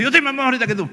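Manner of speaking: mock-cross, amused retort; annoyed mother retort. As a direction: imitate a mother topping her child's complaint. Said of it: "y su mamá dice".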